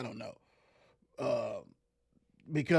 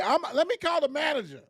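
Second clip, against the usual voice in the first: panicked store clerk; 7 semitones higher than usual. Retold or imitated. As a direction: play a flustered cashier yelling for backup. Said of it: "What they say?".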